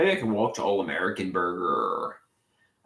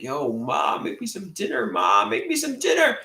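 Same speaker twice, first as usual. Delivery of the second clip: mock call to mom; 11 semitones higher than usual. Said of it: "I'm like".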